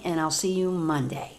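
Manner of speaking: usual speaking voice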